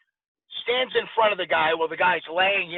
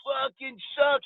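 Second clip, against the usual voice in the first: put-on slow, sloppy jeering drunk, pitched higher; mock drunk heckler; 5 semitones higher, slower than usual. Drunk man heckling the boxers in the crowd, slow jeering. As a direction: drag out the insult like a drunk heckler. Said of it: "he's drunk, and he's going".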